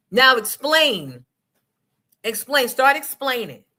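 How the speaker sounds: slow loud demand; stretched demanding now. Acted. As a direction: order him to explain. Demanding he explain.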